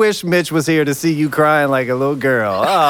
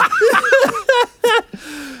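laughing. Acted. Teasing friend who cried.

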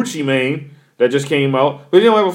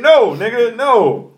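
emphatic refusal; 7 semitones higher, much slower than usual. Real, not acted. Answering whether he likes the song.

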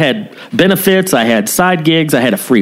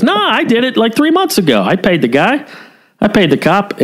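higher-pitched retold protest; mock insistence; 10 semitones higher than usual. Victim tells his doubting brother he paid, mildly insistent.

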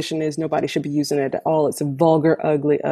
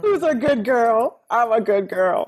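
mocking dog-talk voice; 6 semitones higher than usual. Mocking the old man's praise, higher puppy-talk voice. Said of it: "And I'm like".

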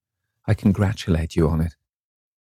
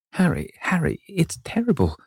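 very high, anguished outcry; high-pitched youthful despair; distressed cry; 10 semitones higher than usual. A narrator's reading. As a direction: cry out the name in horror. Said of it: "cried the lad".

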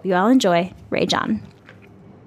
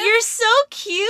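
gushing squeal; 12 semitones higher than usual. Real, not acted. Gushing over cohost's cute face.

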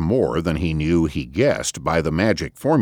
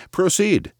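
high sarcastic proceed; mocking. A narrator's reading. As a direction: toss it off mockingly. Gates mocking the president.

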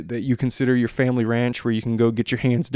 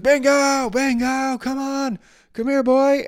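high, loud call for a dog; 14 semitones higher, 3 dB louder, slower than usual. Retold or imitated. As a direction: hail the dog from afar, pitched way up. Owner calling his lost dog.

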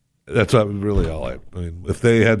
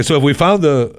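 ordinary talk, nothing marked

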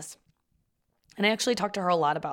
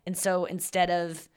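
ordinary talk

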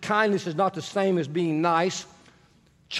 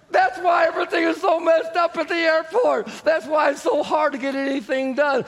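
laughing outburst; 8 semitones higher than usual. Real, not acted. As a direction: burst out laughing and call it out. Laughing outburst at his own story.

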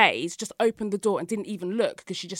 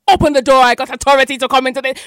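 pounding out the order; loud demand; 6 semitones higher, 11 dB louder than usual. Retold or imitated. Intruder repeating demand.

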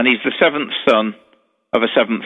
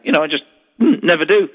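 ordinary talk, nothing marked

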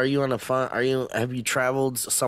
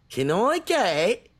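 slow, hammed-up Australian accent; mock Australian; 6 semitones higher, much slower than usual. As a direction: order cinema tickets as a cartoon Aussie. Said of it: "our impression of an Australian".

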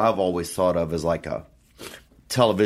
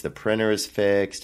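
ordinary talk

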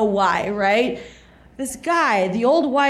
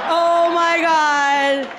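long drawn-out, higher gush; higher, stretched-out excitement; mock swooning; 5 semitones higher, much slower than usual. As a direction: gush slowly, stretching every word.

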